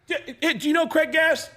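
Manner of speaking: pushy, high-pitched hollering across a table; Belzer calling out. Retold or imitated. Belzer calls Chris over to sit down, high insistent shout. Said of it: "Richard stands up and goes".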